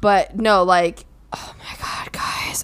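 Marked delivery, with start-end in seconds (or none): 1.3-2.6 hushed whispered aside. Real, not acted. Bride telling viewers about the first look, awed whisper. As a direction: breathe it out, still overwhelmed.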